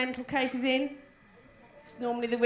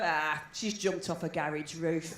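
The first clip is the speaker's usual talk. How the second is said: slow, low, softer imitation of a dawning conclusion; knowing realisation; 9 semitones lower, 5 dB quieter, slower than usual. Posh people hearing her accent, low knowing verdict. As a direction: drop low and slow, as if it all makes sense. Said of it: "they'd hear my accent and go".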